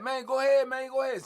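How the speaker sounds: shouting a dare at the man below; daring taunt. Retold or imitated. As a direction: egg him on, loud and cocky. Rival taunts Stink on the rail.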